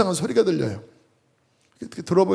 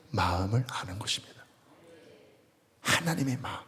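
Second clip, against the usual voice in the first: whispered emphasis; 6 semitones lower, 12 dB quieter than usual. Real, not acted. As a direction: drop to a whisper on the key point. Preacher hushes key point.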